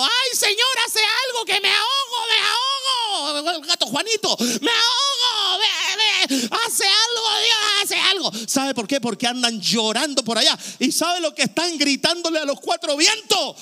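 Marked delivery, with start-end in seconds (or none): 0.0-8.3 very high, loud, crying panic; hammed-up drowning plea to God; desperate crying. Preacher mocking desperate people crying out to God, high sobbing panic. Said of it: "hay gente que anda desesperado".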